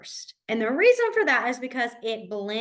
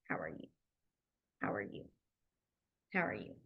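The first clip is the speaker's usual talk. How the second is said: much softer, under the breath; quiet practice repeats; murmured practice; 14 semitones lower, 16 dB quieter, faster than usual. Shown as a demonstration. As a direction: murmur it as you sign along. Quiet demo while signing.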